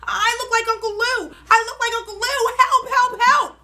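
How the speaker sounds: loud, high cries for help; frantic shouting. A narrator's reading. Girl running from grandpa, frantic loud shouts. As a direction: wail it while running away. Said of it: "I kept running and shouting".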